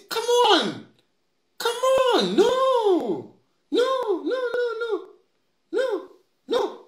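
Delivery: high, whiny repeated no; mock wailing protest. Mocking MPs who cry foul in debate, high whining no. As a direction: wail no like a hurt child.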